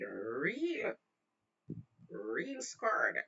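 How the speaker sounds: REAL stretched long, said twice; drawn-out emphasis. Retold or imitated. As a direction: drag out the word real for effect. Stretches words to show fear.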